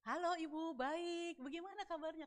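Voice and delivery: high, lilting imagined reply; imagined cordial greeting; hoped-for friendly reply. Retold or imitated. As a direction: answer sweetly, drawing out "baik". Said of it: "Ekspektasi saya, harapan saya".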